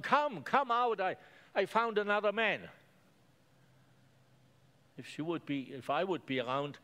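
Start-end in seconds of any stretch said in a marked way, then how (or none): 0.0-2.7 high, louder call to the whole village; woman calling the villagers with excitement; excited calling out. Acted. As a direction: shout the good news to everyone.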